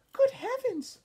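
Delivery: put-on high startled old-lady voice; Grandma Thora voice. Grandma reacting to D W's spots, high and startled. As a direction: gasp it out like a fussy grandma. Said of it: "said Grandma Thora".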